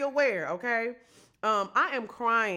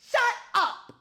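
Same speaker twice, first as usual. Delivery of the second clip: excited shout; 8 semitones higher, 4 dB louder, slower than usual. Retold or imitated. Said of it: "They said".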